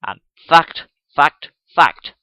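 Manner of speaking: loud, pounding fact fact fact; emphatic repetition. Acted. Gradgrind echoing the gentleman, loud and emphatic. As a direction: hammer each fact down. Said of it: "repeated Thomas Gradgrind".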